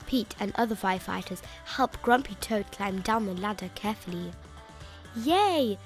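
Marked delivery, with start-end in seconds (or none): 5.1-5.8 high, stretched cheer that swoops down; happy cheer. Acted. Storyteller cheering that the fire is out, happy cheer. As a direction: let out a happy yay.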